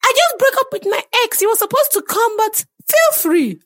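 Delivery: very high and loud, gushing; dramatic high voice. Acted. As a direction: overdramatic squealing lady. Acted woman in meet cute scene.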